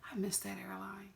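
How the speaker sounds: whispered wistful aside; murmured regret. Real, not acted. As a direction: whisper it to yourself.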